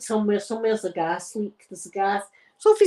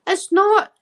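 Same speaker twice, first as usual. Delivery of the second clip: higher, louder indignant protest; higher indignant outburst; mock indignation; 8 semitones higher, 4 dB louder than usual. Real, not acted. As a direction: bristle at the claim. Indignant over clype.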